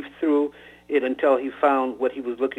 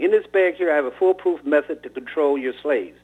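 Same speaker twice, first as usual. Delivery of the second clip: ordinary delivery, nothing marked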